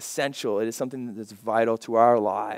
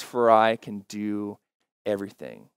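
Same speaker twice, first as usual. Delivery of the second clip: slow reading; 3 semitones lower than usual. Shown as a demonstration. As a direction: read it slowly so it sinks in. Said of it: "I like to read it a little bit slower".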